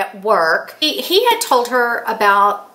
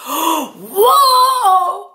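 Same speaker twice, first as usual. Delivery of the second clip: high, gasped mock amazement; amazed gasp; 9 semitones higher, 4 dB louder than usual. Grandson hearing about the guillotine, acted-out amazement. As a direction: imitate an awestruck kid's gasp and exclamation.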